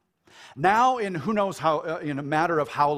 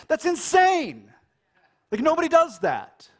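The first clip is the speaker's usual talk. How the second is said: astonished; 11 semitones higher, 6 dB louder than usual. Real, not acted. Preacher stresses cross is unthinkable.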